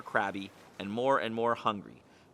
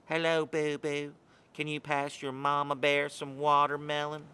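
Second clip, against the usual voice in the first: mock mama bear; 2 semitones higher than usual. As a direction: coo like a fake mother bear. Gull pretends to be the mom.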